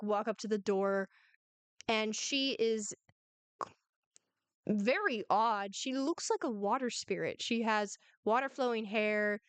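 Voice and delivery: normal talking voice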